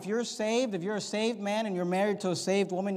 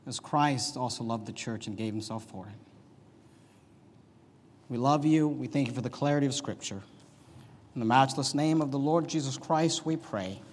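closing prayer; 4 semitones lower than usual. Acted. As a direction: pray humbly before God.

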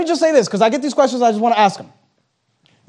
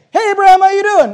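cheery greeting; 10 semitones higher, 6 dB louder than usual. Acted. Cheerful greeting acted in sermon.

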